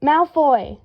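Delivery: loud call across the pub; booming call. A narrator's reading. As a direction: boom the name across a crowded room. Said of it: "someone boomed".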